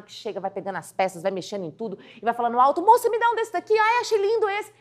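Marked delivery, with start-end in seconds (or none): 2.8-4.7 high, gushing shopper; enthusiastic customer. Acted. Eager customer grabbing clothes, high and excited. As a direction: squeal with delight over the clothes. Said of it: "toda entusiasmada".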